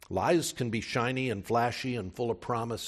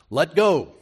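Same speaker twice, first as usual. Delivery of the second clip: calm command; 7 semitones higher, 11 dB louder than usual. Retold or imitated. God's answer to dangling man.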